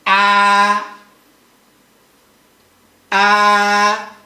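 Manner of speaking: long high level ah; sustained flat first-tone "ah", loud and even. Shown as a demonstration. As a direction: hold a steady high "ah" with no pitch movement. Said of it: "the first tone is a high flat".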